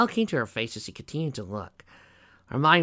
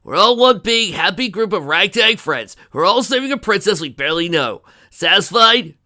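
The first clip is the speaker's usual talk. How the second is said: loud, high, exasperated rant; frustrated outburst; 7 semitones higher, 12 dB louder than usual. A narrator's reading. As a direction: give in with noisy exasperation. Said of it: "let out a grunt of frustration".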